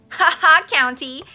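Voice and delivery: loud, high mocking taunt; gleeful jeering cry. A narrator's reading. Carmelita laughing at Olaf's stumble, jeering cry. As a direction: crow at someone's stumble. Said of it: "cried Carmelita".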